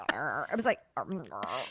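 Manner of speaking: low gurgling burp noise; imitated gurgle. [name] imitating her own burp, low gurgle. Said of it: "You're gurgling".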